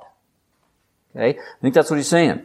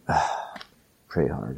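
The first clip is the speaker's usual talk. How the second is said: mock weary grumble, much softer and lower; grumbling skeptic; 12 semitones lower, 12 dB quieter, slower than usual. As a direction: sigh it out like a tired believer. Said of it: "You might be thinking".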